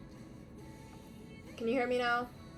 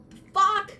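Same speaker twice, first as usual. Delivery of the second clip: angry; 11 semitones higher, 10 dB louder than usual. Real, not acted. Outburst at phone.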